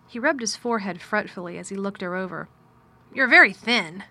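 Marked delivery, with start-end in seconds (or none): 3.1-4.0 louder curt master asking; gruff man voice. Acted. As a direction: ask it with cool distance. Mr Craven speaks.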